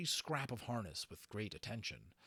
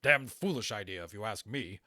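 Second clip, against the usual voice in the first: mock gruff; 7 dB louder than usual. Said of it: "belying his words".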